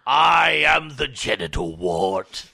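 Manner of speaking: slow loud ancient monster voice; grinding stone statue. Pretend. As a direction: announce yourself like a woken stone idol. Mock ancient being announcing itself.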